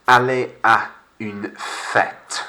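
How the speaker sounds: aller à une fête slowly, fête as fet; slow French model. Shown as a demonstration. Teacher gives phrase for pupils to repeat, slow French.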